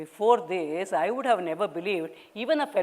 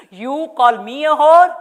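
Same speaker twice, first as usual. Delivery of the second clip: loud, higher outraged retort; furious; 6 semitones higher, 12 dB louder than usual. Acted. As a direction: throw his word back at him. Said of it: "started cursing him".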